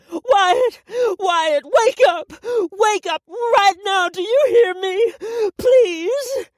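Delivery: loud, high, slow screams breaking into crying; sobbing scream. Acted. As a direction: shout him awake, then break down sobbing. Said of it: "She started to sob".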